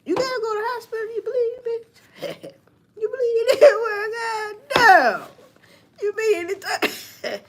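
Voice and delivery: high falsetto mock sob, fast then breaking into laughs; mock crying woman. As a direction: wail it like a hurt girl, half laughing. Mocking the injured girl, crying.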